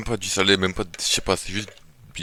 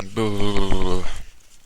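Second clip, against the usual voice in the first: loud coughing fit; 9 dB louder than usual. Real, not acted. Gagging after food.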